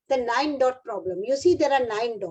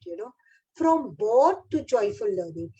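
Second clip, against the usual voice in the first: ordinary talk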